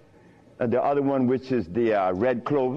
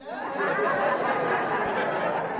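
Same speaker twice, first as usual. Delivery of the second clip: a breathy high-pitched laugh. Real, not acted. Speaker amused by his own line about babies. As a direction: laugh it off lightly.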